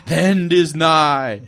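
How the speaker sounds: high, slow, loud doom voice; mad scientist. Pretend. Doom voice after a laugh.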